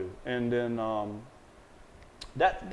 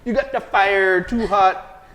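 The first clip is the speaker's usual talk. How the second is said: loud, raised, hammed-up Jamaican accent; terrible Jamaican accent; 8 semitones higher, 7 dB louder, slower than usual. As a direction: do a jokey, badly faked Jamaican accent. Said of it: "a terrible Jamaican accent".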